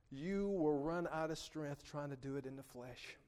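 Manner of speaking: normal talking voice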